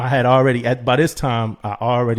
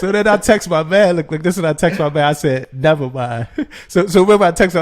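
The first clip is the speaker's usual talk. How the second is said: excited retelling; 5 semitones higher, 7 dB louder than usual. Real, not acted.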